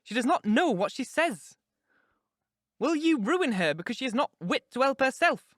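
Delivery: high, agitated, vehement scolding; alarmed, impassioned outburst; passionate. A narrator's reading. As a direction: reproach him frantically, pitched high. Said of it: "I said passionately".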